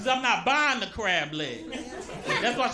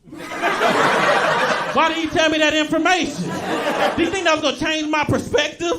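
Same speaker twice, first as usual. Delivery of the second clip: loud comic exasperation; exasperated outburst; 3 semitones higher, 5 dB louder than usual. Acted. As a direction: demand an answer, fed up and loud. Exasperated at plane seatmate.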